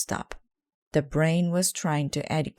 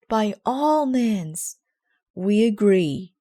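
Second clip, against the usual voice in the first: passengers' voices; 7 semitones higher, 3 dB louder than usual. A narrator's reading. Bus passengers responding.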